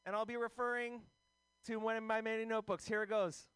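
high-voiced mock stand-up opening; Pancake. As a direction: pitch it way up like an eager comic. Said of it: "Here's my impression of Pancake".

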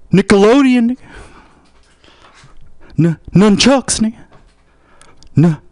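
high-pitched, excitable heckler voice; high put-on voice with rising line ends; taunting heckler. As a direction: goad them in a high, pushy voice. Plays taunting street heckler.

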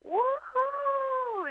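high whooping cheer like a crowd; mock crowd whoop. Comic imitating the crowd cheering back, high whoop. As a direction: cheer like the whole room. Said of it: "the crowd would go like".

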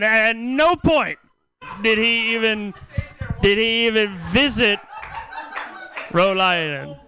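slow, drawn-out reveal; slow punchline. Real, not acted. Telling crowd Poe never visited Rhode Island, slow and drawn out. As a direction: drag out each word to the punch.

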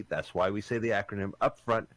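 ordinary talk

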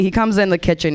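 ordinary talk

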